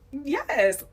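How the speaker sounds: high, loud approving yes; cheering approval. Retold or imitated. Woman reacting as a man gets called out, cheering. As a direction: let out an emphatic yes.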